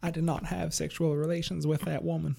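soft, raspy Bill Clinton voice; Bill Clinton impression. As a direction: do the Clinton denial. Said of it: "sounding like Bill Clinton".

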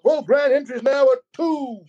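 high, drawn-out announcer call; powwow emcee. Retold or imitated. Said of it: "a powwow M C or announcer".

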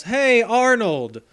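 hammed-up friendly kid; mock cheerful Drew. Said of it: "and goes".

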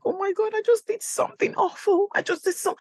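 high, rushed horror at herself; mortified squeal; panicked confession. Retold or imitated. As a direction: blurt it out to a friend. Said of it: "I'm like".

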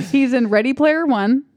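ordinary delivery

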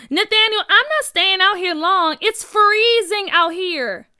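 loud, high impatient holler; calling out. Acted. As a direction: call across the ice to a friend. Friend calls out across the lake.

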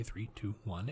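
hushed countdown under the breath; quiet countdown. Shown as a demonstration. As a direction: count down softly before speaking. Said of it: "I need to count down every single sentence I say".

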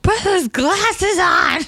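hammed-up struggle shout; mock strained yell. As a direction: force the words out mid-wrestle. Imitating the fighter forcing glasses.

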